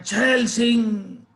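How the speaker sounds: high, drawn-out desperate yell; loud shout. A narrator's reading. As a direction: shout the suddenly remembered name, stretching it out. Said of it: "जोर से चिल्लाता". (ज़ोर से, खींचकर चिल्लाते हुए)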